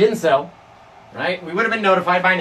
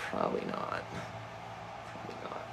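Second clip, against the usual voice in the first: whisper; 20 dB quieter than usual. Real, not acted. Trailing off whisper.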